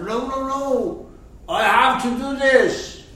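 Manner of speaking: high, loud, stubborn protest; insistent refusal. Acted. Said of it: "So I insist".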